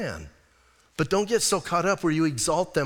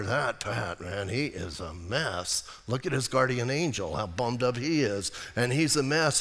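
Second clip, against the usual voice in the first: low, hushed accuser; Satan accusing; 5 semitones lower, 6 dB quieter than usual. Retold or imitated. Satan telling the Father Pat is a mess, in a low sneering murmur. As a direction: sneer the charge quietly to the Father.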